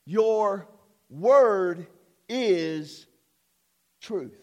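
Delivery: "Your" "word" "is" "truth" each set apart by pauses, "word" loudest. Shown as a demonstration. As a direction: space out "Your word is truth" one word at a time. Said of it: "Now, notice".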